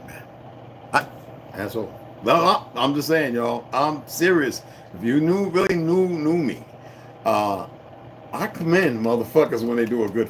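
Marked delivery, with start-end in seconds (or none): none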